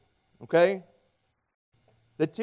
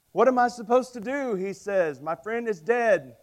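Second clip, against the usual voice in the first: higher, louder panicked caller; 6 semitones higher, 5 dB louder than usual. Retold or imitated. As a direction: plead down the phone in panic. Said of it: "And he's panicked".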